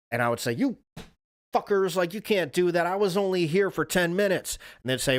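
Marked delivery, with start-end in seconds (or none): 0.5-4.6 higher, raised complaint at the attendants; imitating himself yelling at the parking booth; mock outrage. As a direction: ham up the indignation at being charged. Retold rant at parking attendants.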